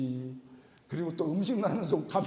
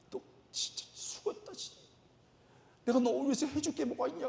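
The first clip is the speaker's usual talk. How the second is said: mild tearful; 8 semitones higher, 4 dB quieter than usual. Retold or imitated. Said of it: "내가 울면서".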